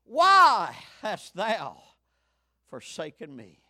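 loud, slow why, then falling away; anguished cry. Acted. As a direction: cry the why, let the rest fade. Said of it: "The answer of this cry".

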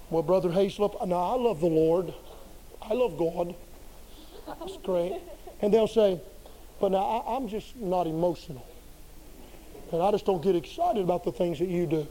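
low, soft, flat imitation of a reserved member; a lightly voiced stiff churchgoer, low and quiet; flat unemotional churchgoer. Mimicking a stiff church member who says he's not emotional, low and soft. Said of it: "here's exactly what they say".

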